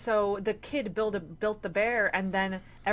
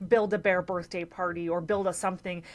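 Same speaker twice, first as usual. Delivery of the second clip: normal talking voice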